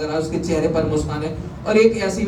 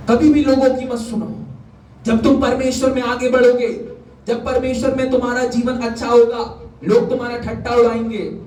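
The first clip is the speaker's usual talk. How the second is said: raised, emphatic exhortation; preaching; 7 semitones higher than usual. Real, not acted. Preacher warns congregation not to heed mockers, high and emphatic. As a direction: urge them on in a high preacher's voice.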